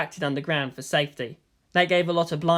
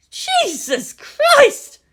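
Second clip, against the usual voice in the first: much higher, drawn-out horrified gasp; gasped shock; 8 dB louder, much slower than usual. Acted. Gunner gasping at the fire, horrified. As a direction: gasp it out in horror. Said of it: "gasped his gunner".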